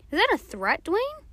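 teacher's cool challenge, rising at the end; unworried challenge. A narrator's reading. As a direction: question him, untroubled, voice lifting on the name. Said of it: "didn't sound too worried".

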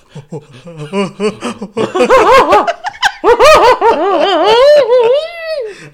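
long, high, loud cackling laugh; shrieking laughter. Real, not acted. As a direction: laugh helplessly, climbing in pitch. Big laugh mid-remark.

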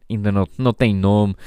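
normal talking voice

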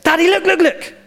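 put-on high, insistent little girl calling daddy; high childish pestering; imitating pestering daughter. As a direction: whine it like a kid wanting attention. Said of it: "it drives me bonkers".

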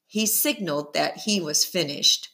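plain reading voice

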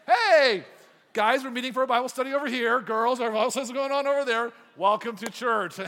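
big, high shout across a dance hall; acted-out booming welcome, loud throughout; calling out. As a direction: holler directions to the whole crowd. Acts out pastor addressing school dance.

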